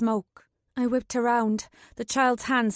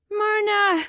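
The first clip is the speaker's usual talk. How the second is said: high, louder yell; 10 semitones higher, 5 dB louder than usual. A narrator's reading. Katrin yells for Myrna, higher and louder. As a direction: yell her name across the walls. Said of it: "I yelled".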